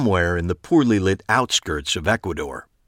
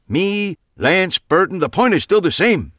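dismissive; 11 semitones higher, 5 dB louder than usual. A narrator's reading. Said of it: "Max said".

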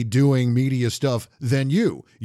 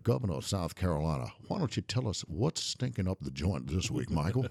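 H R McMaster; 6 semitones lower, 10 dB quieter than usual. Retold or imitated. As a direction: imitate an antebellum Charleston governor. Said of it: "in the words of H R McMaster".

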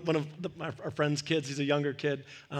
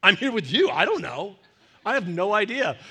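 loud, higher, laughing protest; exasperated laughing reply; 4 semitones higher, 7 dB louder than usual. Retold or imitated. Pastor answering the puzzled couple, loud and laughing. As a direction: throw up your hands, laughing. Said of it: "I'm like".

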